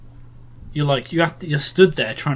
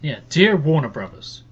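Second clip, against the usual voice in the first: ordinary delivery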